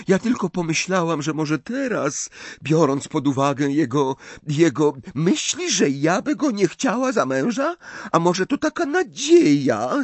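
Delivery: put-on a young French bride, wounded and defiant; Fleur, French accent. A narrator's reading. Fleur answers Mrs Weasley with a high French accent.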